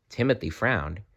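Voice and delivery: usual speaking voice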